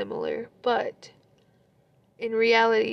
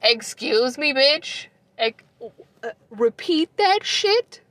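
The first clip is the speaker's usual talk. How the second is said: sassy, higher-pitched disbelief; incredulous comeback; 4 semitones higher than usual. Retold or imitated. Retelling her stunned reply to her ex, incredulous. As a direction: demand a repeat, pitch climbing as you go. Reacting to his new relationship.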